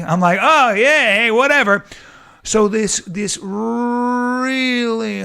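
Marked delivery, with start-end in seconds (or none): 0.4-1.8 mock shrug. Shrugging off the girl's jab.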